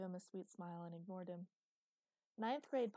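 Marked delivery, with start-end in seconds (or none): 2.4-2.9 high, loud, eager announcement; a bright kid proudly correcting her dad; little girl bursting to correct him; proud child. Acted.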